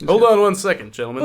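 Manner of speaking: ordinary talk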